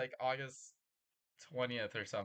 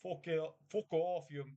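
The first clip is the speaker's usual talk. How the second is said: mock British insults; 4 semitones higher than usual.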